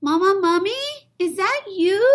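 put-on high little-kid plea; squeaky begging little mummy; pleading child. Baby mummy calling out.

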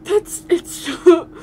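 slow words through tears of laughter; crying laughing. Real, not acted. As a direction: wheeze it out mid laugh.

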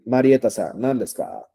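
ordinary talk

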